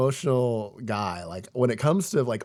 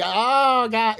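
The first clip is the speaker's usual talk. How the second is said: high, loud mock wail of Oh God; hammed-up crying Oh God; 10 semitones higher, 6 dB louder than usual. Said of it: "I'd cry".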